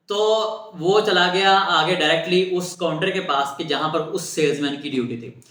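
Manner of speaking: usual speaking voice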